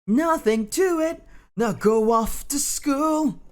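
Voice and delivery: high, slow, drawn-out boast; hammed-up high heroic pride; proud. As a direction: puff up proudly, pitched high and stretched out. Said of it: "Burnt Reflection said proudly".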